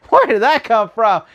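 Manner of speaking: high, disbelieving burst through laughter; incredulous laughing outburst. Retold or imitated. Reviewer retelling his reaction in the cinema, incredulous and laughing. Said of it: "I'm like".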